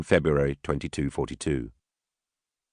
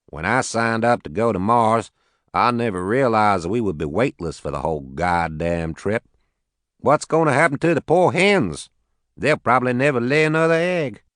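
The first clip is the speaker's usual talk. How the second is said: put-on easygoing Arkansas farmer, Southern accent; 6 semitones higher than usual. Max to the Watanabes, a light Southern accent. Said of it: "He had a heavy southern accent".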